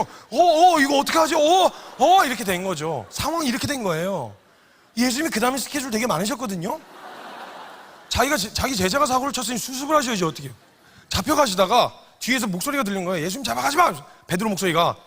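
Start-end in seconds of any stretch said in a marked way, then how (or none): 0.0-2.2 higher, louder flustered yelling; panicked shout. Retold or imitated. Peter panicking after cutting off the servant's ear, shouting. 13.2-13.9 higher mock battle cry; mock yell. Peter swinging a sword at the guards with a mock battle cry.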